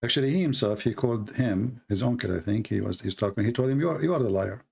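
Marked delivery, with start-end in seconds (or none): none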